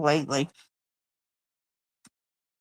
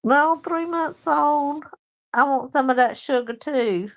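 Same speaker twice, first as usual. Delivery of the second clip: put-on baby talk cooing; 8 semitones higher than usual. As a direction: coo it like talking to a baby. Cooing over a baby grandson.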